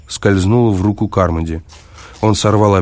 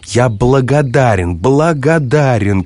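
higher, drawn-out cry, moderately raised; exasperated cry; 5 semitones higher, 3 dB louder, slower than usual. A narrator's reading. Carmody insists to the city that he is grateful, crying out. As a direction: cry out the repeated word, pitched up and stretched. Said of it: "крикнул Кармоди".

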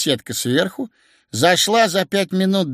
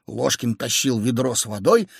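ordinary delivery, nothing marked

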